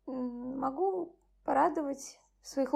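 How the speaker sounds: usual speaking voice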